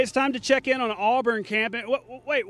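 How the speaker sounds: ordinary delivery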